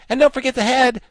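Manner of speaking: very high, loud exclamation; exclaiming. A narrator's reading. Wogglebug reminds Tip as he sprinkles powder, exclaiming. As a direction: pipe up urgently, far above normal pitch. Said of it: "exclaimed the Wogglebug".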